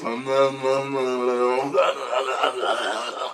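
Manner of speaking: lightly voiced munching noms; mock chewing nom. Giant chewing in the dreamer's face, playful munching noises. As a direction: chew the noms in his face. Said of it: "and he goes".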